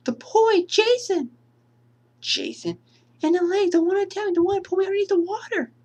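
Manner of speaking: high trembling insistence; frightened young woman. Acted. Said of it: "from Alice at the end of the movie".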